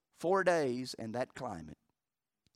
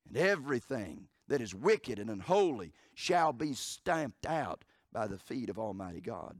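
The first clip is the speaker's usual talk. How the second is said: ordinary talk, nothing marked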